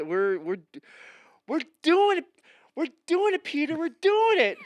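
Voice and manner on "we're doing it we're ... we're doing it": mock triumph. Excited triumph, high voice.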